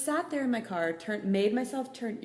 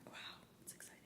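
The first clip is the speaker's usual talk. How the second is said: whisper; 27 dB quieter, much faster than usual. Real, not acted. Reacting to summer countdown.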